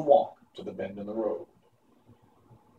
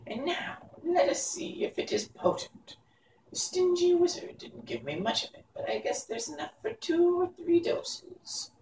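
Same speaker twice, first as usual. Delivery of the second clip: high crone voice, soft and sly; scheming old witch; 5 dB quieter than usual. A narrator's reading. Mombi prepares to sprinkle the powder on Jack. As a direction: mutter slyly like an old witch.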